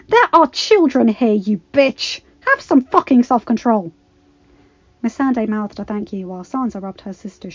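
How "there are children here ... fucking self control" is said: loud, enraged scolding; furious shouting. A narrator's reading. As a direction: yell at him in rage. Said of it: "face twisted in rage".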